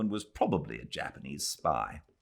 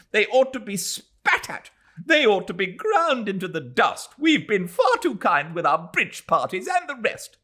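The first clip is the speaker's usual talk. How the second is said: high, loud furious harangue; wrathful tirade; 13 semitones higher, 6 dB louder than usual. Acted. Mrs Turton haranguing the men, loud furious tirade. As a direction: rail at the men. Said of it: "profiting by her wrath".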